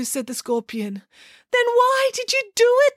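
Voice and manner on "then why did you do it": very high, exasperated question; shrieky, acted indignation; desperate demand. A narrator's reading. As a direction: demand an answer in outrage. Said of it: "demanded Frida".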